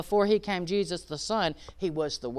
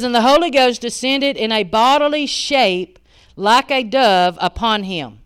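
scripture reading; 2 semitones higher, 9 dB louder than usual. Acted.

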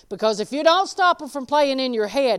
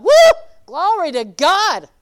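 excited praise; 7 semitones higher, 13 dB louder than usual. Retold or imitated. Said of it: "And I'm like".

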